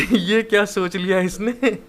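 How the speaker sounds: words breaking into real laughter; laughing. Real, not acted. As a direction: laugh through the line. Narrator cracks up at scene. (हँसते हुए बोलना)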